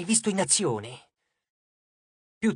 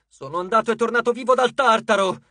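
a growled defiant boast, pitched higher; Nico growling; 4 semitones higher, 3 dB louder than usual. A narrator's reading. Nico growling at Cupid about Tartarus. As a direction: snarl it back at the god. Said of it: "ringhiò Nico".

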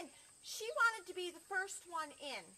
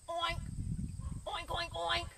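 loud oink, then a quick run of three; pig oink; 3 semitones higher, 5 dB louder than usual. Acted. Pig repeats itself to the librarian, oinking loudly. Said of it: "And Pig said".

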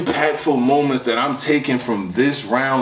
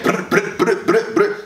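raised, rolled machine-gun imitation; imitated gunfire; 5 semitones higher than usual. Reviewer acting out the switched cadence, imitated gunfire. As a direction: burst of trilled gun noises.

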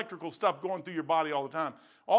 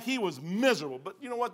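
ordinary talk, nothing marked

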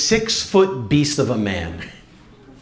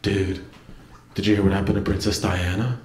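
low, quick, dim big-brother impression; dopey brother voice; 7 semitones lower, 6 dB quieter than usual. Little brother telling his sibling the news, low and dopey. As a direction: play him low and earnest, a bit dense. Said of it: "He's like".

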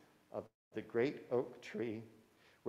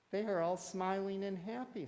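delighted fairy voice; 8 semitones higher than usual. Acted.

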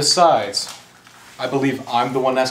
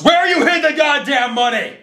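high, forceful yell of demand; angry shout; 12 semitones higher, 4 dB louder than usual. Acted. Kidnapper demanding money from captive, angry shout.

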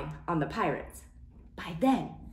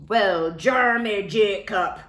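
louder pirate greeting; pirate captain; 6 dB louder than usual. Acted. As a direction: greet the boy as a swaggering captain. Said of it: "he said".

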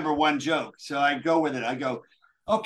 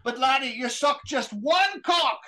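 Scottish punchline; 4 semitones higher than usual. Acted. Punchline in McGregor's voice.

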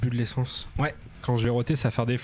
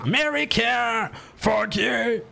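shouting; 7 semitones higher, much slower than usual. Shown as a demonstration. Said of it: "T'es en train de crier".

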